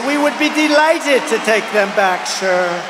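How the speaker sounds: hammed-up eager official bowing to the boss; eager mock compliance. Foreign official answering the president, mock eager and loud.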